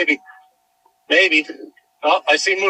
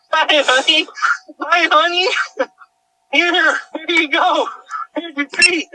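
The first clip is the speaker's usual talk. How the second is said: excited dog greeting; 10 semitones higher than usual. Real, not acted. Owner greeting her found dog Cammy, excited high baby talk. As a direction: gush over the found dog.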